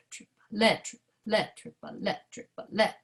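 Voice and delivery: triplet with the let punched; triplet, tied and accented on let; stress on let. Shown as a demonstration. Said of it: "if I tie the two together".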